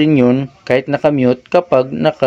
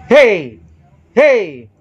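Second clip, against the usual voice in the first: shout; 5 semitones higher than usual. Shown as a demonstration. Sound tech shouting to test the speaker. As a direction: shout it at the mic. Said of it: "Sisigaw ako".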